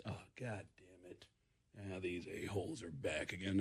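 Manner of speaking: low, very soft grumble, imitating his buddy; dismayed muttering. Buddy spotting police out the window, muttered dismay. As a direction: mutter it low under your breath, annoyed.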